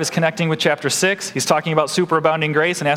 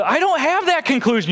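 high indignant protest voice; mock objection; 11 semitones higher than usual. Pastor voicing the objector, high protest. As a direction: play the stubborn objector, pitched up. Voicing the other side in argument.

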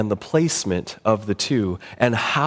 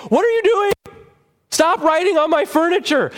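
exasperated gift giver; 3 dB louder than usual. Retold or imitated. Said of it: "you're saying".

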